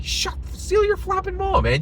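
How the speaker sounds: high-pitched scolding outburst; exasperated rebuke. Retold or imitated. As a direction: snap at a meddler to shut up. Exasperated rebuke of judgmental parents.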